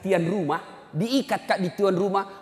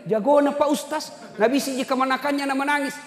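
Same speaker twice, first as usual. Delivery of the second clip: amazed onlookers; 10 semitones higher, 4 dB louder than usual. Retold or imitated. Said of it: "Heran semua orang lihat".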